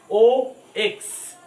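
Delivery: ओ x said slowly, each letter drawn out; slow spelling. Shown as a demonstration. Teacher spelling fox for children, slow and loud. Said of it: "फा निकला".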